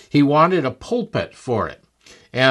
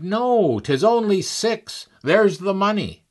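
much higher, insistent cry; eager protest; 8 semitones higher than usual. Retold or imitated. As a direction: protest innocently that it's only six. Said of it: "And Billy Bray cried".

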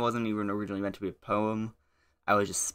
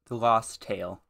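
normal talking voice

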